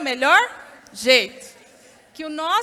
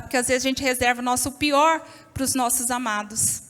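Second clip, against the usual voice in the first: ordinary delivery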